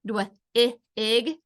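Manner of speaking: dwig broken into separate sounds dw-, i, ig; segmented phonics demo of dw-, i, ig; sounded out. Shown as a demonstration. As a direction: say the blend, then the short i, then ig, with gaps. Teacher sounds out rhyming words.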